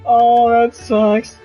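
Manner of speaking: usual speaking voice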